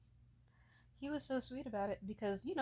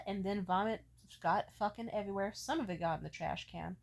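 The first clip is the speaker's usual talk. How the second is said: usual speaking voice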